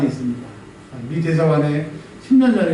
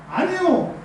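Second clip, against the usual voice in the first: raised, high, fervent sermon voice; preaching at full force; 7 semitones higher than usual. Acted. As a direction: press the point home to the congregation. (열정적으로 설교하듯)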